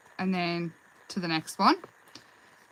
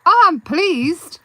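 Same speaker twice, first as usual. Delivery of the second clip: slow, louder pleased exclamation; delighted; 7 semitones higher, 9 dB louder, much slower than usual. Real, not acted. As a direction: sound really delighted. Reacting happily to a viewer comment.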